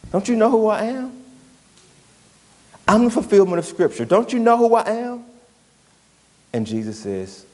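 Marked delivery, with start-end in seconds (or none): 0.1-5.3 higher, puffed-up voice demanding recognition; boastful tempter. Acted. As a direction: crow it like a proud show-off. Tempting voice urging Jesus to flaunt power.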